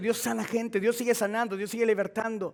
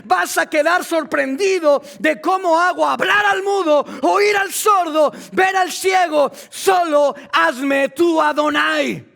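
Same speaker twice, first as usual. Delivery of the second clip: fiery preaching; 6 semitones higher, 6 dB louder than usual. Acted. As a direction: boom it out as God's challenge. Preaching climax at full force.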